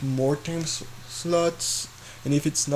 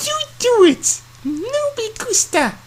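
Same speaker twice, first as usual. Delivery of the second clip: squeaky high cartoon bubble voice; high squeaky cartoon girl; 6 dB louder than usual. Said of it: "my bubble voice is terrible".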